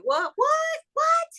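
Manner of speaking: very high, squealed questions; excited disbelief. Retold or imitated. Coach hearing someone's good news, thrilled. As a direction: shoot the pitch right up, eagerly. Acts out her eager celebrating reaction.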